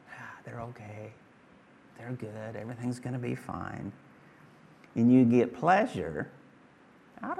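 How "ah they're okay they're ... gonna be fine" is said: put-on much softer, hushed relief over a sleeping child. Parent checking a sleeping child, softly relieved. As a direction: breathe it out softly so the child stays asleep.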